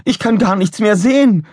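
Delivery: very high, loud complaint; alarmed cry. A narrator's reading. Officer blinded by slimed window, alarmed cry. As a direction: shout it high in dismay.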